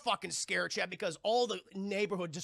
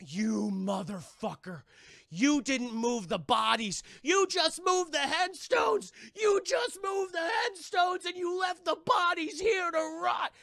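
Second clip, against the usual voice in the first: slow, loud, rising scream of rage; father confronting the developer, screaming; furious accusing shout; 10 semitones higher, 7 dB louder, slower than usual. Acted.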